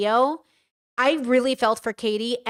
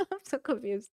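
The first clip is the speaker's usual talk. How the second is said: baffled mutter; 10 dB quieter than usual. Real, not acted. Reacting to the boyfriend's outburst, dazed and soft. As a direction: mumble it, lost for words.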